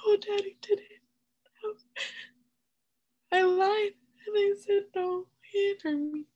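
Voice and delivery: high, breaking voice, crying while telling; real crying, voice high and cracking; tearful. Real, not acted. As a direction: choke the denial out through real tears. Retelling denying to crying father.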